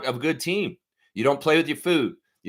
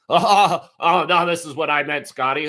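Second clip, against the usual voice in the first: mocking the reporter; 4 dB louder than usual. Mocking reporter's laugh and words.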